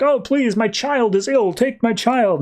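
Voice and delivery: high, pleading voice of a parent begging; mock desperate plea. Parent begging aliens to take their sick child, high and pleading. Family member begs the aliens.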